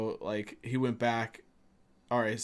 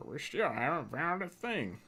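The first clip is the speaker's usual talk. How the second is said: higher-pitched mocking parrot of the guard; annoying voice; 4 semitones higher than usual. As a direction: imitate him in an irritating put-on voice. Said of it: "most annoying voice so far".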